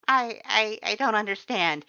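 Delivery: high, loud stammer, flustered; sputtering. A narrator's reading. Flustered skeleton after she holds his hand, stammering. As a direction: trip over the words, embarrassed. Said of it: "Pap sputtered".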